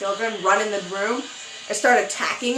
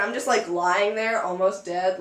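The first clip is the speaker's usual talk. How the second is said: ordinary talk, nothing marked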